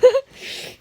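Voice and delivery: high burst of laughter; laughing. Real, not acted. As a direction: laugh out loud. Laugh after remark.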